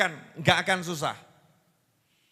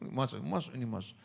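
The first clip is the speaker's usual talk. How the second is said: hushed, softer murmur to the husband; whispering to husband; 10 semitones lower, 10 dB quieter than usual. Retold or imitated. Preacher voicing wife whispering to her husband, hushed. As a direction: coax him in a low hush. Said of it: "Suaminya dibisik".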